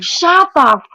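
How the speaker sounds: sharp high yell; screamed. Retold or imitated. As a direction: snap it at the noisy room. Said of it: "I mean, I screamed".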